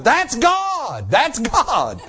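a wife leaping up with joy; excited wife. Retold or imitated. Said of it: "my wife jumps up going".

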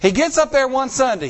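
ordinary delivery

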